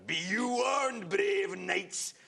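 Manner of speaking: roaring sorcerer voice, high and slow; Tim the Enchanter. Acted. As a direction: thunder the warning like a mad wizard. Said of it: "who says things like".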